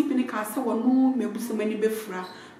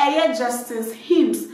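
usual speaking voice

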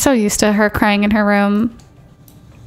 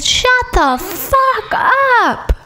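high, very slow, stretched-out mock yell; hammed-up high imitation of a snapping man; mock angry quote; 12 semitones higher, much slower than usual. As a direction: squeak it out like a cartoon tantrum. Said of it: "He said".

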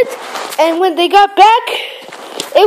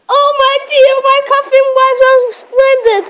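higher, tearful wail; crying lament; 7 semitones higher than usual. Acted. Thomas stuck in the tunnel, crying lament. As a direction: cry out in dismay.

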